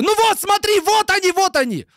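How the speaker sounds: shrieking it like he's overexcited; mock emotional outburst. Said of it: "на эмоции такой". (пародия на возбуждённый визг)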